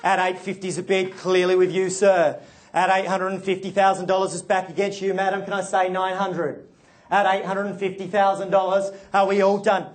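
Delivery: rhythmic auction bid calling; auctioneer call. Real, not acted.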